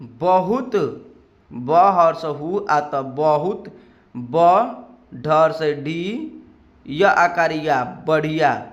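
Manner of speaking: teacher slowly spelling "बहुत बढ़िया" in Hindi. Shown as a demonstration. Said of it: "Hindi क्या होगी?".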